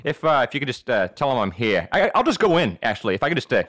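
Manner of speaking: rambling cartoon dad impression; Peter Griffin. Crasher wheedling past the host at the door, cartoon impression. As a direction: talk your way in with a goofy cartoon voice. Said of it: "in Peter Griffin accent".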